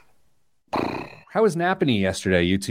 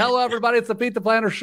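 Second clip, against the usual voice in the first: show opener; 11 semitones higher, much faster than usual. Real, not acted. Host welcomes everybody to the show, high-pitched announcer opening. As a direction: launch the show in a raised, ringing pitch.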